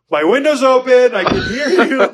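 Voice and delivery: high, loud, laughing wife voice; mock the wife catching him out, laughing; high laughing voice of the wife through the open window; wife's reply. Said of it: "and then she just goes".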